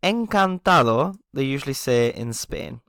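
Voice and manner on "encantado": slow "encantado" with a rising lift; teacher modelling "encantado"; Spanish encantado. Shown as a demonstration. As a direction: stretch "encantado" for learners. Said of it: "I just wanna add a little detail".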